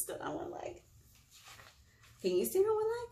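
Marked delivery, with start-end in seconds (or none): none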